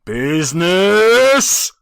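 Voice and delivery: loud, slow outraged cry. A narrator's reading. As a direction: fling the word back at him. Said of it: "cried the ghost".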